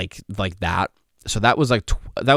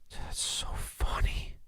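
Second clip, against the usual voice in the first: whispering; 10 dB quieter, slower than usual. Real, not acted. Whispered aside.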